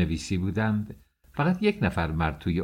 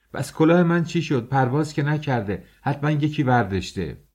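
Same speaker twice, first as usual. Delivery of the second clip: higher, louder outraged yell; angry shout; 6 semitones higher, 3 dB louder than usual. Acted. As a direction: shout at him, demanding the hat back. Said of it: "داد کشیدم". (فریاد عصبانی و بلند)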